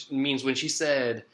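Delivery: ordinary delivery, nothing marked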